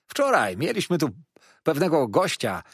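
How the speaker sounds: lively waiter starting a yarn; chatty waiter. A narrator's reading. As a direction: launch eagerly into gossip for the guest. Waiter begins his tale.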